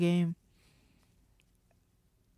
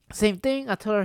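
ordinary delivery

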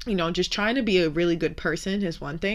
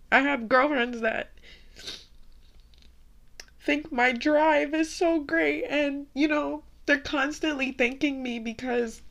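tearful; 8 semitones higher than usual. Real, not acted. Host talks about her friends while tearful. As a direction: still crying, voice pushed up.

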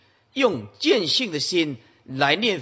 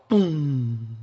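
a spoken bell toll "咚"; bell sound; 2 semitones lower than usual. Retold or imitated. Imitating the temple bell. (模仿钟声"咚")

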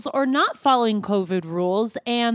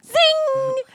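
high triumphant zing; 3 dB louder than usual. Acted. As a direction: nail the punchline. Punchline exclaim.

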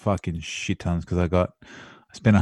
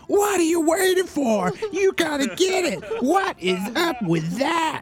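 put-on American accent; slower than usual. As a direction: hype it up, American style. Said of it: "your Donald Trump impression".

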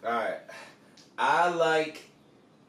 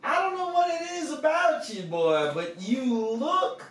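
Southern coach drawl. Pretend. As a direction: play a folksy Southern coach sizing up a recruit. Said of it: "Nick says".

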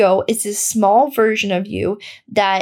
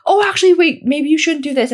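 nervous ego voice; 6 semitones higher, 3 dB louder, faster than usual. Retold or imitated. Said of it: "my ego was like".

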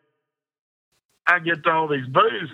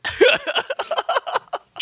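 laughing. Real, not acted. Man laughing about asking the wife, high laugh. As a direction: crack up at the joke. Laughing at his own joke.

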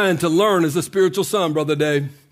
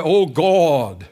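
mock pious prayer; 5 semitones lower than usual. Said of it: "religious garb on when you pray".

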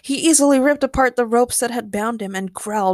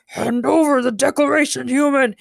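loud, raised villain voice; shouting gorilla villain; 4 semitones higher, 5 dB louder than usual. A narrator's reading. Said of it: "he shouted as he lunged".

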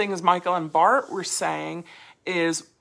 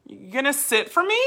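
higher pleading question ending on ME; deferring question; 6 semitones higher than usual. Shown as a demonstration. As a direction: voice climbing to a high FOR ME. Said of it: "you're asking a question and you're deferring".

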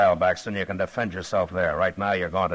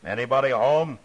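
loud, high hail to the house; calling out; 7 semitones higher, 6 dB louder than usual. Acted. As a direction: call out to whoever's inside. Calling out at ranch.

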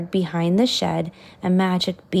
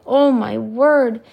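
king crying out; 4 semitones higher, 6 dB louder than usual. A narrator's reading. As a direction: cry out like an exasperated king. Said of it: "The king cried".

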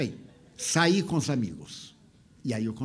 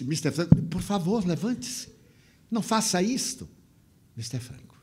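pleading; 6 semitones higher, 3 dB louder than usual. Retold or imitated. Kneeling man begs, crying.

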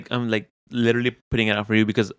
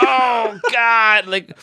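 mock anguish; 12 semitones higher, 5 dB louder, much slower than usual. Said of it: "I'm like".

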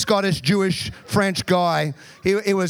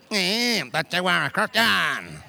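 drawn-out sneer; slower than usual. Retold or imitated. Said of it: "Multiple times I had people go".

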